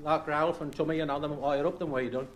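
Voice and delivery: steady, measured reading of a dialect poem; poem recital. Acted. Reciting a dialect poem to the audience, measured poem recital. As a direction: recite it like a rhyming monologue.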